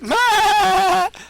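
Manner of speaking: high-pitched burst of laughter; short squeaky laugh. Real, not acted. Laughs at opponent.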